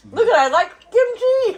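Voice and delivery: high laughing surprise. Real, not acted. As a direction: laugh it out, amazed. Surprised she likes kimchi.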